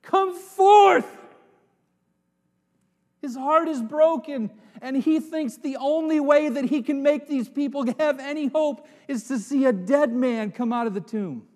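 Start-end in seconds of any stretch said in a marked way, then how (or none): 0.0-1.1 loud, high, anguished call; mourning cry. Acted. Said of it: "he cried out in mourning". 3.2-10.7 raised, high preaching voice; passionate preaching. Acted. Preaching at full force.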